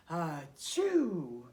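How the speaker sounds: put-on teaching sneeze for children; demo sneeze. Shown as a demonstration. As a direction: show kids how a sneeze sounds. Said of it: "you go".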